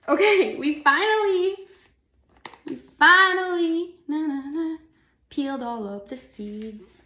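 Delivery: high, loud sing-song cheer; playful victory crowing; triumphant. Real, not acted. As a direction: celebrate a finished chore, drawing the words out high and loud. Celebrating finished seed peeling.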